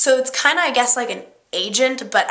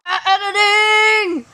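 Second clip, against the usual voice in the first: a playful, much higher shout, drawn out; calling out; 8 semitones higher, 3 dB louder, slower than usual. Real, not acted. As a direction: call the word out slowly and high. Calling out about editing.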